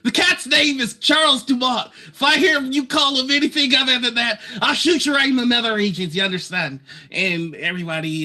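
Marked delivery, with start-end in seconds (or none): 0.0-6.8 Lux yelling. Acted. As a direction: yell it with a crossbow raised. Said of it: "Lux loves yelling and threatening people".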